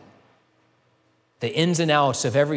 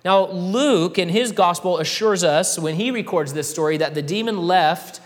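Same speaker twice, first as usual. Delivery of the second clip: raised, louder preaching voice; preaching at full force; 4 semitones higher, 3 dB louder than usual. Acted. Pastor driving home Christ's authority, preaching louder. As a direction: build it up like a sermon climax.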